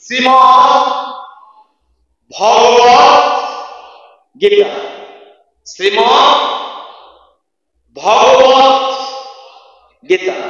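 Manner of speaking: ভগবত with short first vowel, pauses between words; word-by-word pronunciation. Shown as a demonstration. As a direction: sound out ভগবত slowly, no long aa after ভ. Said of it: "লক্ষ্য করুন".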